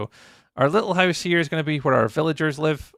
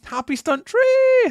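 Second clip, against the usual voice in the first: high, slow call of a welcome; excited greeting; 8 dB louder, slower than usual. Real, not acted. Streamer excitedly greeting a raider on stream. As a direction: sing out the name to greet a raider.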